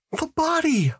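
acted high-pitched scream; contained scream. A narrator's reading. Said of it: "Lois screamed".

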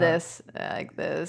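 deliberate creaky fry demo; vocal fry. Shown as a demonstration. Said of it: "a good vocal fry".

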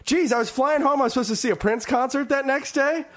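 high, wide-eyed mock amazement; hammed-up bewildered traveler; clueless returning passenger. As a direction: play the dazed guy stepping off the plane. Continues returning passenger bit.

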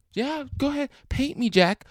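high, swooning mock Titanic girl; high put-on voice begging to be painted; mock Rose voice. Mocking Titanic painting scene.

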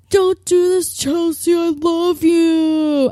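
high, slow, hammed-up movie plea; dramatic confession. Ex-boyfriend begging her not to jump, hammed-up high plea. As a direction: drag out I love you like a soap hero.

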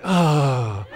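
long falling, self-pitying exhale; pathetic sigh. Acted. Husband fishing for wife's sympathy, pathetic put-on sigh. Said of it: "Makes me breathe pathetically".

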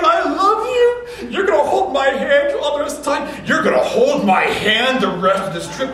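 high, loud mix of love and scolding; tender scolding. Retold or imitated. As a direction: hug and scold a lost kid at once. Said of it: "and you say".

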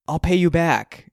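loud, high, drawn-out plea; exasperated. A narrator's reading. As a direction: offer it in exasperation.

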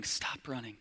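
breathy whisper, almost no voice; urgent hushed whisper to a struggling listener; whispered plea. Acted. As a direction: whisper it like a gentle command. Pleading with the listener to stop.

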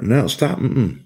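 lightly acted, high-pitched objection; mock firm refusal. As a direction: cut in to halt an outburst. Officer cutting off a screaming inmate.

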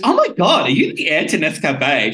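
imitated gushing disbelief, high and loud; amazed girl. Girl learning he's heir to Nescafe, amazed. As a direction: mimic her shooting up in amazed recognition. Retells girl's amazed reaction.

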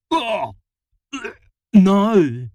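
high, loud gagging outcry; disgusted cry. A narrator's reading. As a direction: recoil in disgust. Sour milk in his eyes.

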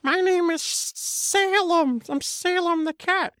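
high, slow cat voice; squeaky slow little cat. Acted. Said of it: "talking to you in a normal voice".

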